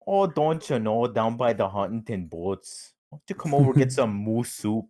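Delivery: mock Minnesotan accent, sing-song; lightly put-on Minnesotan accent; playful Minnesotan accent parody. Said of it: "something called a Minnesotan accent".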